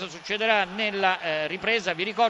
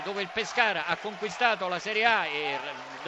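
ordinary talk

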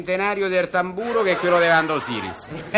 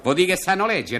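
ordinary talk

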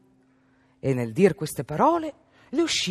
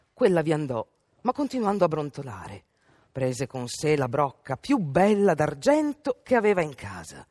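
plain reading voice

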